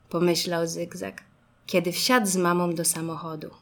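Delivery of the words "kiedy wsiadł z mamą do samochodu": usual speaking voice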